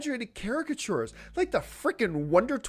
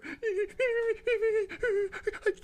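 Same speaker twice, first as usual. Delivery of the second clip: burst of laughter; 14 semitones higher than usual. Real, not acted. Laughing at whiny fans.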